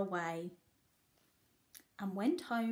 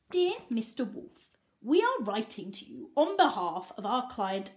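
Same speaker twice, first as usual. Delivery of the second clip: louder, stern official read; formal letter reading; 6 dB louder than usual. Acted. Solicitor's letter to the wolf, loud stern official reading. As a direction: read it like a stiff lawyer.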